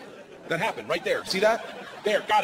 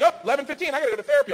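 lightly voiced wife, higher; a breezy hint of the wife; mock-casual wife; wife leaving; 10 semitones higher, 4 dB louder, much faster than usual.

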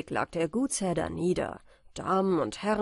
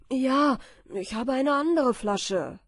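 louder, higher triumphant announcement; exclaiming; 5 semitones higher, 6 dB louder than usual. A narrator's reading. Michael to his wife on coming home, exclaiming. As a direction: cry out the good news. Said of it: "rief er aus".

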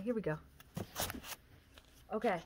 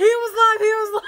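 excited shout; 9 semitones higher, 9 dB louder than usual. Real, not acted. Excited record reveal.

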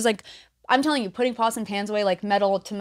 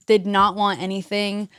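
usual speaking voice

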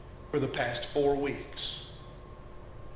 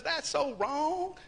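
high, drawn-out "so wrong"; paraphrased rebuke; 10 semitones higher, much slower than usual. Retold or imitated. Preacher voicing Paul's reply to the congregation, drawn-out rebuke. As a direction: draw out the verdict, pitched way up. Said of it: "Paul says".

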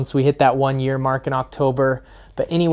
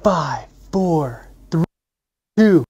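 countdown; 3 semitones higher, 6 dB louder, much slower than usual. Real, not acted. Countdown to start the vlog.

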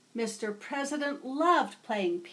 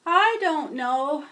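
sighing; 2 semitones higher, 7 dB louder than usual. Acted. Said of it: "Mister President sighed".

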